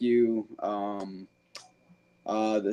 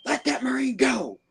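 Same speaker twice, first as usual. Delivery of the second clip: drill instructor yell; 11 semitones higher than usual. Retold or imitated. Drill instructor shouting at buddy.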